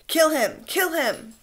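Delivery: high, loud frenzied cry; frenzied shout. Acted. Ralph caught up in the mob jabbing at Robert, frenzied shout. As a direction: lose yourself in the bloodlust. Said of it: "carried away by a sudden thick excitement".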